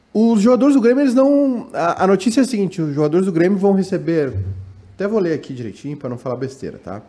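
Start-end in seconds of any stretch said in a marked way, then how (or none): none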